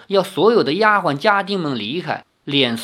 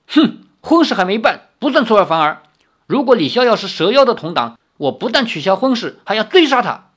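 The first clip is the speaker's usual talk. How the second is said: higher, loud harsh threat; stern anger; 7 semitones higher, 5 dB louder, faster than usual. A narrator's reading. Father threatens to hunt down Li Xiaoyao, stern and loud. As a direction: threaten coldly and firmly.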